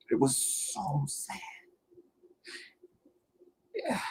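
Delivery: slow, breathy whisper near tears; tearful whisper. Real, not acted. Grieving vlogger whispers about the victim, tearful and slow. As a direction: barely get it out, close to crying. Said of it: "without crying".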